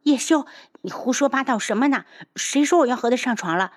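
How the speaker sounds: high-pitched, indignant denial; affronted outburst; flustered scolding. Acted. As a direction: scold back in embarrassment, rushing the end. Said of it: "骂道".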